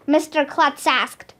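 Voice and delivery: ordinary delivery, nothing marked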